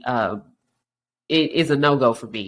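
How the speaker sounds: normal talking voice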